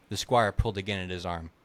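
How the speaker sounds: ordinary delivery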